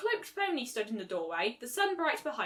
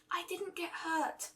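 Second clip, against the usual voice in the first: soft high timid voice; small and slow, shrinking away; shy pony voice; 7 semitones higher, 7 dB quieter, slower than usual. Acted. Fluttershy's voice, shy.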